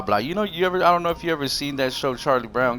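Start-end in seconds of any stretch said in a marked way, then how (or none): none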